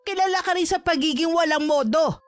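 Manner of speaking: angry mother squaring off; defiant anger. Acted. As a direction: stand up to the bully, unafraid. Mother stands up to the bully.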